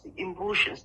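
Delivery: normal talking voice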